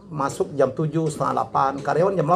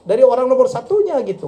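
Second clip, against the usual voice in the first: ordinary talk, nothing marked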